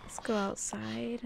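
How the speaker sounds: slow unvoiced whisper. Player sneaking out of the room, whispering slowly.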